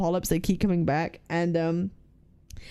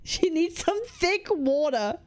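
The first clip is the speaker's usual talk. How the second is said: laughing; 8 semitones higher, slower than usual. Real, not acted. Laughing at chat joke.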